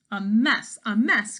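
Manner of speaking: loud echoing "A mess, a mess"; loud cry. Acted. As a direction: cry out in dismay. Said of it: "cried the other hen".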